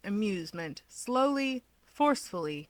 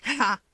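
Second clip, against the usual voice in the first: sharp laugh; 4 semitones higher, 4 dB louder than usual. A narrator's reading. Toya's startled laugh.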